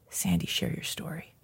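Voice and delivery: soft unvoiced whisper of a calling voice; whispered inner voice. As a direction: breathe it like a voice in her head.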